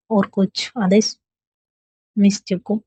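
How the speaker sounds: slow, playful flirting tone; flirty teasing. A narrator's reading. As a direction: tease her slowly, like flirting. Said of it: "फिर flirt के mode में कहती".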